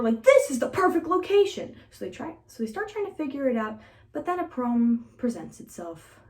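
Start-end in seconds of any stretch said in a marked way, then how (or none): 0.2-1.8 high, loud burst of mock delight; excited exclamation in a lifted voice; acted excitement. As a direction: announce the find with raised pitch and volume. Acted quote of twins finding site.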